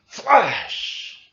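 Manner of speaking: high, loud popped "Flash!"; mock camera flash.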